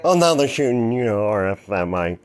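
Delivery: normal talking voice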